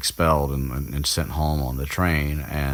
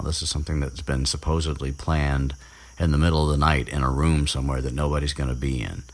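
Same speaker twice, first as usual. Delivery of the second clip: ordinary talk, nothing marked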